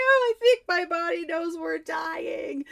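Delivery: high crying wail; hammed-up sob of panic; mock crying wail. Retold crying during attack.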